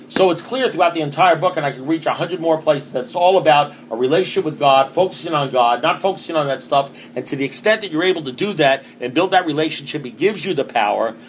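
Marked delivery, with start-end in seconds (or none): none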